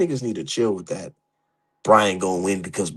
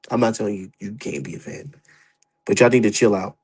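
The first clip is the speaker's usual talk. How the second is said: normal talking voice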